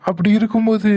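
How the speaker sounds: ordinary delivery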